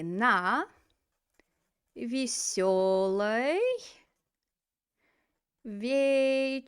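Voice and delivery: drawn-out весёлой and вечеринке with long pauses; word by word. Shown as a demonstration. Teacher dictating to students, на весёлой вечеринке very slow and spaced. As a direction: stretch every syllable of на весёлой вечеринке, pausing between words.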